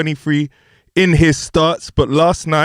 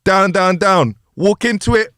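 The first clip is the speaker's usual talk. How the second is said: ordinary talk, nothing marked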